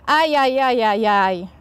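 drawn-out yelping ajajaj, slow; slow startled puppy yelp; yelp of cold water. A narrator's reading. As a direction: yelp as icy water hits. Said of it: "Piesek wrzasnął".

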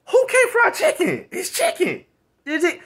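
high, loud incredulous rant; incredulous outburst. Real, not acted. Vlogger scoffing at men who won't cook, high pitched. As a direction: scoff at how easy it is. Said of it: "rhetorical outburst".

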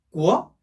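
"꾸어" rising in pitch; second tone. Shown as a demonstration. Teacher answering for second tone, "꾸어" rising. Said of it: "이 성이니까 뭐죠".

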